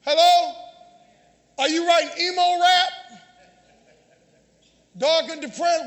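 usual speaking voice

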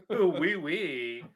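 mock French "oui, oui"; playful French accent. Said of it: "French pronunciation".

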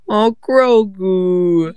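slow drawn-out cooing aw; cutesy baby voice. Shown as a demonstration. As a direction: coo it slowly like at a baby. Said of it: "say it in a cute way".

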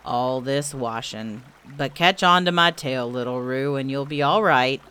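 low donkey grumble; weary, low-pitched muttered complaint; grumbling Eeyore. A narrator's reading. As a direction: grumble to yourself, deep and resigned. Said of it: "grumbling quietly to himself and".

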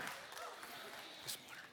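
breathless whispered aside. Real, not acted. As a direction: whisper it off-mic, winded. Winded pastor asks for water.

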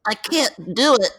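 high, goofy cartoon voice reading a text; hammed-up cartoon character; Homestar voice. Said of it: "reading them with an accent".

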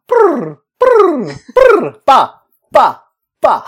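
high loud lip-trill babble; losing my mind. Real, not acted. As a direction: sputter nonsense like coming unhinged. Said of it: "on the verge of a mental breakdown".

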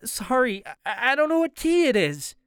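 high, tearful, stammering apology. A narrator's reading. Anthony doesn't know which key it is, tearful. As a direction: apologise haltingly as if close to tears. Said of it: "sounding like he's been crying".

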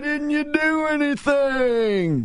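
high, long-drawn shrill wail; mock shrill screaming. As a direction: stretch each word in a high mocking screech. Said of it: "screamed at the top of their shrill voices".

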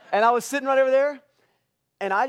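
laughing. Real, not acted. Laughing at own tearful memory.

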